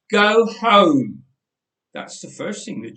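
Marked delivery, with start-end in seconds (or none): 0.1-1.3 loud, curt dismissal; stern order. A narrator's reading. Helpers sending the families away, loud and curt. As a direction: send them away coldly.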